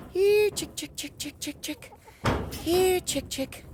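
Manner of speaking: high sing-song chick call; calling chickens. Real, not acted.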